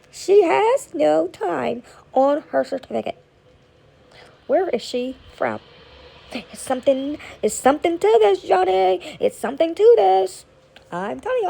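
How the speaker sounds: hammed-up documentary amazement; mock investigator. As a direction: play a breathless reality-show investigator.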